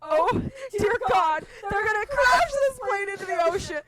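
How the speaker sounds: high, loud panicked wail; mounting panic. Retold or imitated. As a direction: build from alarm to a hammed-up cry. Retold panic as plane lands.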